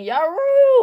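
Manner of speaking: very high, loud whooping yell; shout. A narrator's reading. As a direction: leap from the saddle with a wild cry. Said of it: "leaping from their saddles shouting".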